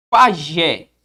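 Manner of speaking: "Pajé" loud and drawn, strong final "jé"; "Pajé" pronounced forcefully on its last syllable; stressed final syllable. Shown as a demonstration. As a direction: lean hard on the "jé" of "Pajé". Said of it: "a pronunciada com mais força".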